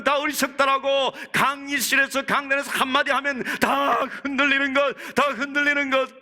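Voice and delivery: high, sustained pulpit delivery; impassioned preaching. Real, not acted. Preaches on children losing faith.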